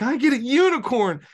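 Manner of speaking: high, loud gleeful squeal; excited bubbly girl. Retold or imitated. Bubbly player to game master about her unicorn, excited squeal. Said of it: "She gets all excited".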